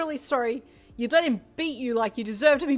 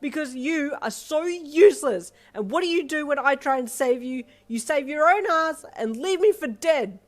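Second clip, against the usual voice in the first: angry; 10 semitones higher, 14 dB louder than usual. Acted. Mother blaming her daughter for the beatings, furious. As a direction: hurl every accusation. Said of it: "she said angrily".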